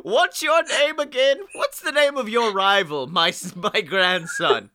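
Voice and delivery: high, hammed-up professor voice; game professor. Professor asking a new trainer for names, hammed-up character voice. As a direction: put on a forgetful old professor, pitched well up. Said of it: "the professor".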